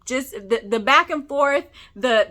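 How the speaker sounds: ordinary talk, nothing marked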